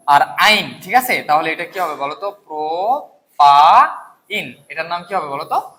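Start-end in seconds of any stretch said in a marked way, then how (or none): 2.3-4.5 propyne stretched syllable by syllable, very slow; slow model word. Shown as a demonstration. Teacher to students, modelling propyne very slowly. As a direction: draw out propyne slowly so they can copy it. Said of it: "আমি যেভাবে বলি এইভাবে করো".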